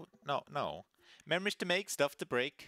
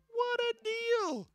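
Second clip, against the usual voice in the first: cheesy announcer; 4 dB louder, much slower than usual. Acted. Continues announcer bit.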